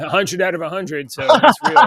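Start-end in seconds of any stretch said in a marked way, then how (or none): 1.1-1.9 bursting into laughter, higher and louder; laughing. Real, not acted. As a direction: crack up on the punchline. Laughing at own news joke.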